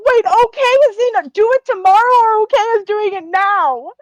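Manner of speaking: very high, loud bewildered questioning; flustered confusion. Acted. Character unsure whether to do it now, flustered. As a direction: pitch it way up, thrown between two options. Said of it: "it's like looking back and forth".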